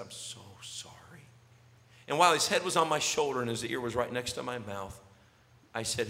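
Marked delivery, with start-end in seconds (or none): 0.0-0.9 soft, whispered condolence in his ear; whispering sympathy to a weeping father; tender whisper. Real, not acted. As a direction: murmur comfort into his ear. Hugging the grieving father.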